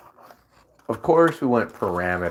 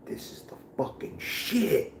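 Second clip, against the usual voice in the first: hushed mock hype; 10 semitones higher, 6 dB quieter than usual. Mock quoting gamers' hype.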